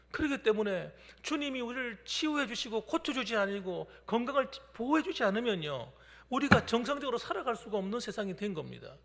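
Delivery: loud, driving pulpit delivery; forceful preaching. Acted. Pastor preaching the cross to congregation, loud and forceful.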